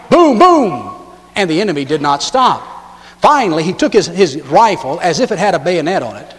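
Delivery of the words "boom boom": louder, higher boom boom; mock battle cry. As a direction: shout as you lob the grenade. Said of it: "He cried".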